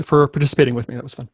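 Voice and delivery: normal talking voice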